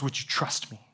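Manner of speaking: unvoiced soft plea; whispered question. God asking a stubborn believer for trust, in a whisper. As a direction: whisper it gently.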